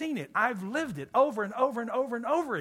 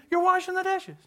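higher, exasperated wife; annoyed wife; 11 semitones higher than usual. Retold or imitated. Wife snaps at husband over the dishes, higher and exasperated. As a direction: play the irritated wife.

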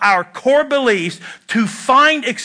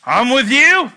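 emphatic; 4 semitones higher, 4 dB louder than usual. Shown as a demonstration. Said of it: "there's a difference between saying".

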